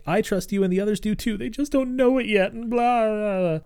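high-pitched earnest kid imitation; mock pleading kid. Boy reassuring his coach, mock high-pitched pleading. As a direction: plead up high, then dissolve into blahs.

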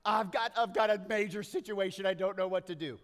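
high, loud, panicky plea; mock Facebook plea. Preacher mimics a desperate Facebook post, loud and high. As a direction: wail it like a dramatic friend online. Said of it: "when someone on Facebook says".

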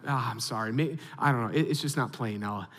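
low, soft, letting her down gently; subdued apology. Retold or imitated. Dad to daughter, song not on the radio, subdued apology. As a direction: apologise quietly, voice sunk low.

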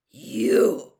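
loud, drawn-out accusing cry; fury and fear. A narrator's reading. Karkaroff, shocked at seeing Moody, furious and fearful. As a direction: burst out in horrified fury. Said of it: "A terrible look of mingled fury and fear".

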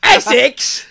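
high, loud British Essex attempt dissolving into laughter; laughing attempt at an Essex accent on request. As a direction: try an Essex accent and crack up. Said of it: "Let's hear Essex right now".

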